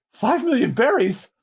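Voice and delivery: normal talking voice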